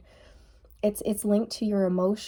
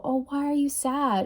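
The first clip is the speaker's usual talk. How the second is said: coddling; 6 semitones higher than usual. Retold or imitated. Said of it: "be like".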